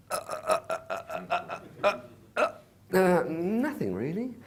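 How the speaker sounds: nervous halting uh uh before nothing really; stammering. Retold or imitated. Stammering nervously when Jay asks what he does. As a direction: stammer it out, caught off guard. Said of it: "stammering reply to Jay".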